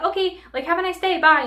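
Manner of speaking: mock-sweet fake-polite dismissal; curt brush-off. Coworker ending the call on her, fake-cheery curt goodbye.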